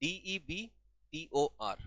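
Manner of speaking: slow letter-by-letter spelling; slow, quiet spelling of the word. Shown as a demonstration. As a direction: spell डीईबीटीओआर one letter at a time, slowly. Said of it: "debtor की spelling क्या होती है?".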